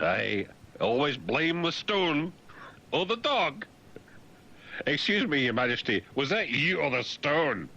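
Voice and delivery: lightly voiced royal banter; hammed-up royal. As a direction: play a fussy courtier. Royal joke about groaning stone.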